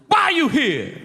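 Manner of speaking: high mock inmate voice; gruff inmate question. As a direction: ask like a curious convict. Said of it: "the prisoner goes".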